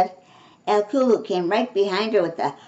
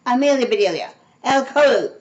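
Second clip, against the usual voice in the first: loud, raised-pitch scolding call; shouting; 5 semitones higher, 6 dB louder, faster than usual. A narrator's reading. Missus Rogers calls the culprits, shouting. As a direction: shout the names sharply. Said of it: "shouted Missus Rogers".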